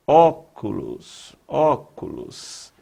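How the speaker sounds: "Óculos" drawn out very slowly, weight on the Ó; stressed first syllable. Shown as a demonstration. Teacher showing the strong syllable to students, stressed and drawn out "Óculos". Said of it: "palavra cuja sílaba mais forte".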